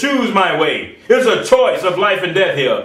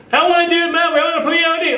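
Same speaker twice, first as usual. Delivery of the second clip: put-on whiny child; 8 semitones higher, faster than usual. Preacher voicing a whining child.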